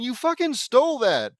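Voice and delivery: high, loud cry of outrage; outraged shout at catching a thief; indignant outburst. Real, not acted. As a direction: explode in high-pitched accusation. Indignant at a copied line.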